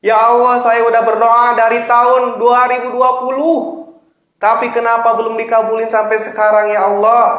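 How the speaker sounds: loud, high protesting prayer; impatient complaint. Acted. Preacher imitates worshipper tired of waiting since 2020, complaining. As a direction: complain insistently up to God.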